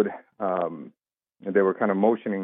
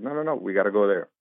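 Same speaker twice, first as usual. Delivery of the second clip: ordinary talk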